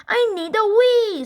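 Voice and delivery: high, loud scream of I need a wee; Henry screaming from the back seat. A narrator's reading. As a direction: shriek to make mom stop. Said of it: "screamed Henry".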